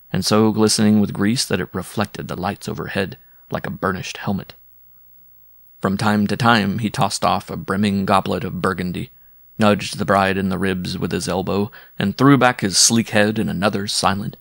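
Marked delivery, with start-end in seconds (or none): none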